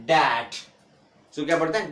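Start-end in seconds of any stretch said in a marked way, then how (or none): 0.1-0.5 डैट, no b sound before the t; silent B. Shown as a demonstration. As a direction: say डैट with the b left out. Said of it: "तो क्या करेंगे".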